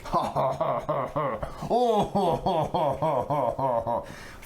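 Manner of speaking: long, hammed-up villain ho-ho-ho; evil laugh. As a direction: put on a drawn-out evil mastermind laugh. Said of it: "your evil ho, ho, ho laugh".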